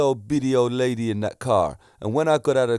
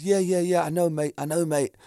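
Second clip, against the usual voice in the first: lightly put-on English accent, higher and quick to agree; imitated English accent; 5 semitones higher than usual. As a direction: retell him with a hint of an English accent. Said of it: "talking in this English accent".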